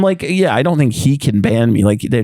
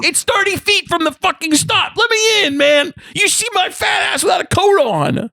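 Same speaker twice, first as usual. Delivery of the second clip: real angry rant. Real, not acted. Venting to friends about the bus driver, furious shout. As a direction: let the anger burst out in high shouts. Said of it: "validating my anger".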